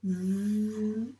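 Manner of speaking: "V" as one long vvv buzz; sustained sound of "V"; letter sound. Shown as a demonstration. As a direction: hold the consonant of "V" with no vowel. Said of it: "fait le son V".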